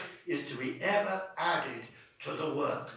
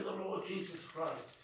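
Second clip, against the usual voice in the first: ordinary talk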